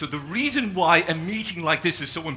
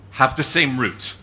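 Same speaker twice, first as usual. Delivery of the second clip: normal talking voice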